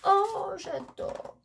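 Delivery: high, delighted burst of admiration; delighted gush. Real, not acted. Crafter discovering a cute page, delighted gush. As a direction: gasp "oh" high, then gush.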